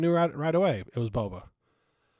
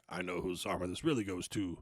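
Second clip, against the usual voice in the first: low character voice; 7 semitones lower, 5 dB quieter than usual. Retold or imitated. Imitating the character's line.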